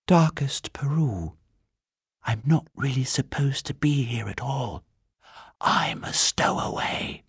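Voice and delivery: whispered confidentially; secretive whisper. A narrator's reading. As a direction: whisper a secret, glancing round. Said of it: "looked round carefully before replying".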